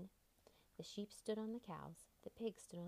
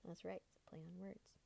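soft aside in a whisper; 9 dB quieter than usual. Real, not acted. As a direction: confide the joke quietly. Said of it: "another play word".

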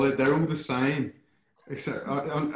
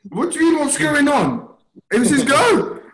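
high, loud indignant; indignant protest; 10 semitones higher, 5 dB louder than usual. Real, not acted. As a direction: protest like it's unfair. Protesting whose turn it is.

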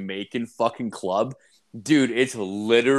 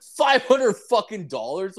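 much higher, outraged echo of the price; incredulous outburst; 10 semitones higher, 4 dB louder than usual. Retold or imitated. Customer balks at the girl's price, high incredulous outburst. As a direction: pitch leaping up in mock disbelief.

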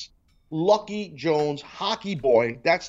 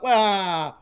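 mocking pundits; 7 semitones higher than usual. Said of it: "everybody's talking about".

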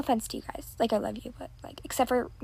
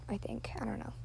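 soft whisper to herself; whispered aside; 15 dB quieter than usual. Real, not acted. Girl quietly corrects herself in a whisper. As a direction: whisper it under your breath.

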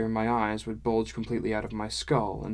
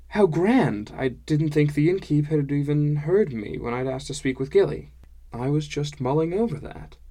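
higher, eager and pleased; pleasantly surprised, pitched up; delighted narrator hero; 4 semitones higher, 5 dB louder than usual. Acted. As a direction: gush lightly at good news.

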